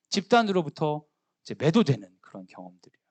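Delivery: normal talking voice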